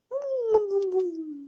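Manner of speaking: high cooing chant of bunjing; baby talk. Acted. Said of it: "ganun pala yung baby talk". (mataas na baby talk)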